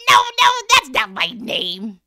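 loud, high impish refusal; little man voice. Acted. The imp rejects the queen's guess, loud and high. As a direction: sing it out like a gleeful imp.

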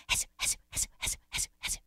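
quiet voiceless hiss in pulses; soft slithering hiss. Acted. Snake keeps going underground, soft rhythmic hissing. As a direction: hiss it softly and evenly.

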